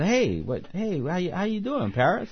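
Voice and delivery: high-pitched imitation of a casual greeting; Easy. Easy dropping by on Paris, imitated greeting. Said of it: "Easy, you know, drops by and says".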